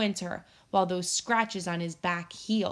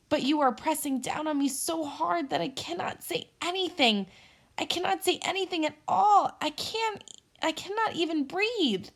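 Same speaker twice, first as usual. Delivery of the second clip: pleading, squeezed squirrel; 7 semitones higher than usual. A narrator's reading.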